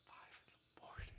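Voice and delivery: soft, breathless whisper. Real, not acted. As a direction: drop to a whisper in dread. Dreading early mornings.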